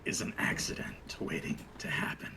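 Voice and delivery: menacing Jack. Acted. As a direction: purr a threat close to his ear. Jack threatening the halfling.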